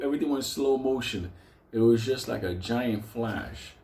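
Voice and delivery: normal talking voice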